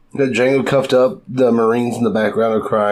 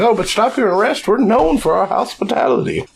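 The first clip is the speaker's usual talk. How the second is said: much higher, sugary host voice; mock innkeeper; 10 semitones higher than usual. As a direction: play a fake-cheerful innkeeper selling sand. Mocking desert town hospitality.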